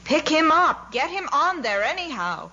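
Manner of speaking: high, loud order to the bearers; shouted, swooping command; urgent shout. A narrator's reading. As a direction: yell it impatiently across the field. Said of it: "someone shouted".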